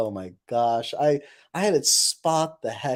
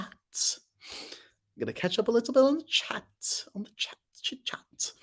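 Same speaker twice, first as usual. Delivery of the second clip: higher, soft, rising lilt; silly singsong; 9 semitones higher, 5 dB quieter than usual. Pretend. Streamer muddling along while scrolling chat, high silly singsong. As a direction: let the pitch climb playfully.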